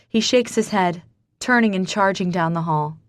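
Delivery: ordinary delivery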